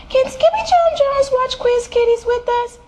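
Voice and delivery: put-on very high squeaky child voice; little kitten sister. A narrator's reading. Kitten girl asking mama about TV, high little voice. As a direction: pipe up on one high note.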